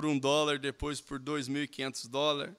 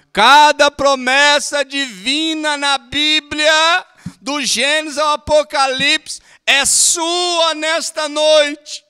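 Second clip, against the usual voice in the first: much louder, higher, slow proclaiming shout; preached shout; 11 semitones higher, 18 dB louder, much slower than usual. Real, not acted. Preacher proclaiming the promise to the congregation, slow shout. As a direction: thunder the promise to the whole church. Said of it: "Ele diz aqui".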